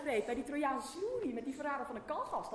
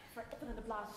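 usual speaking voice

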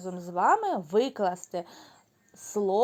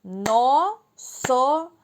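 clapped syllables; 2 semitones higher, much slower than usual. Shown as a demonstration. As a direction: clap out Носоріг slowly. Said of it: "Плескаємо в долоні". (повільно, по складах, з плесканням)

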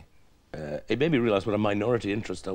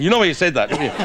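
loud, raised mock threat; menacing interrogator; 2 semitones higher, 8 dB louder, faster than usual. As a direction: corner them with a hammed-up accusation. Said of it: "pin him to the wall and say".

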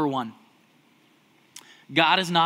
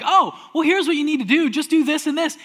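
know-it-all husband; 10 semitones higher, 7 dB louder than usual. Retold or imitated. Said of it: "we're like".

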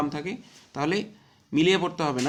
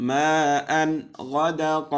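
Quran recitation; 4 semitones higher, 8 dB louder, much slower than usual. Shown as a demonstration. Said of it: "teacher repeats recitation".

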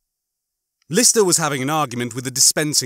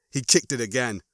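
plain reading voice

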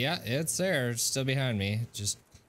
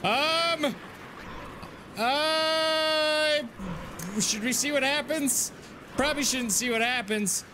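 scream into giggles; 9 semitones higher than usual. Real, not acted. You scared me so bad.

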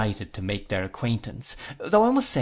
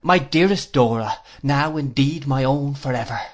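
usual speaking voice

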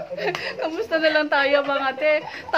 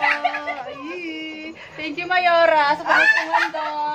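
laughing thanks; 3 semitones higher, slower than usual. Real, not acted. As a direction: gush with gratitude, half laughing. Thanking for Christmas gift. (masayang pasasalamat na may tawa)

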